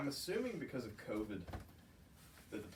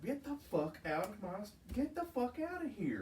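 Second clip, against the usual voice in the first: yelling him off; 11 semitones higher than usual. Retold or imitated. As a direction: chase the intruder off, voice rising. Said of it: "I'm like".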